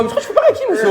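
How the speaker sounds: very high mocking squeak; mock him in a tiny high voice; laughing high-pitched taunt at the tough guy; squeaky mock taunt.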